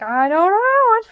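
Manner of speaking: high, loud panicked cry; retelling her own frantic panic, high and loud. Retold or imitated. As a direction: blurt it out in total panic. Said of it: "And I'm like".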